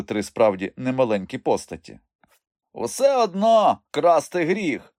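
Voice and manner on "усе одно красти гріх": high, slow, loud reproof; admonishing. A narrator's reading. Uncle wagging finger at young thief, admonishing. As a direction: lecture him, drawing it out. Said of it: "погрозив перстом Вуйко Микульця".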